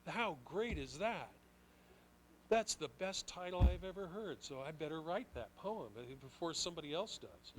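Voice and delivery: thrilled, loud inner voice; excited. Retold or imitated. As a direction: burst out with a new idea. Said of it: "And I thought".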